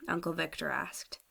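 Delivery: usual speaking voice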